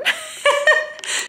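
loud, high-pitched burst of laughter. Real, not acted. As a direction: let out a squealing laugh. Overcome after thanking her listeners.

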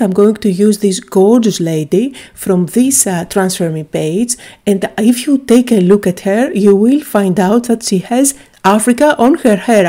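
ordinary talk, nothing marked

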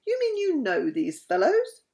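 incredulous question, pitched high; surprised. A narrator's reading. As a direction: ask it high, hardly believing it. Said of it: "The guard looked surprised".

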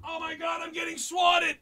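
mock panic. Fake panic, then says just kidding.

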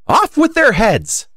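high, slow, loud decree; furious queen's shout; shouted royal order. A narrator's reading. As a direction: bellow the sentence, stretching each word.

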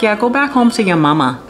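mocking taunt. Carmen taunting Don Jose as he goes, mocking. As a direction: tease him lightly as he leaves. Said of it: "she makes fun of him".

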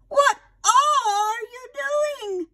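high, slow, loud fretting aunt; a dismayed old lady calling to her bird; shocked aunt. Acted. Aunt Sarah calls to Tilly outside, high slow dismayed.

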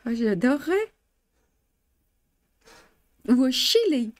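usual speaking voice

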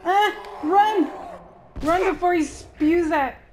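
high, loud alarm; panicked cry. Real, not acted. Player flees before monster spews junk, panicked high cry.

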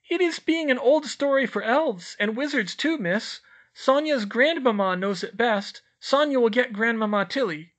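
very high, loud squeak; a tiny house-elf squeaking a greeting; squeaky elf. A narrator's reading. Elf greeting the children in a high squeak.

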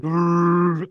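high, held howler monkey call; imitated monkey howl. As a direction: howl like a monkey in one drawn-out note. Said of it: "Monkeys were howling".

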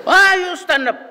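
high, loud scolding in a put-on voice; mock angry professor. Professor scolding student who stood up, high mock anger. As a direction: bark it like a furious old professor. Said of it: "sabi niya".

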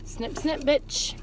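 ordinary talk, nothing marked

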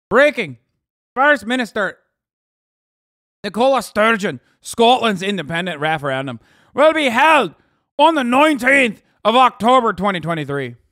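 put-on loud, high Irish accent news flash. Streamer reads breaking referendum news to viewers, mock Irish accent. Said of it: "I'm doing an Irish one".

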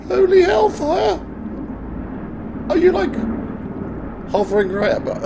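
high, loud startled shout. Real, not acted. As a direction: yell over the noise overhead.

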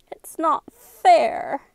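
high, loud, drawn-out wail; close to sobbing. A narrator's reading. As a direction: wail it slowly, on the verge of tears. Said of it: "Sarah was close to sobbing".

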